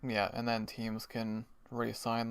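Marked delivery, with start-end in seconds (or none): none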